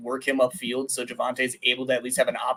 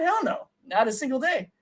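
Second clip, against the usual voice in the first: much higher, emphatic hell no; excited denial; 10 semitones higher than usual. Real, not acted. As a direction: shoot it down with real excitement. Emphatic denial in lively debate.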